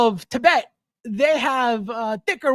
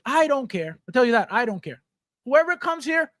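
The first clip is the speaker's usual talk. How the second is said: ordinary talk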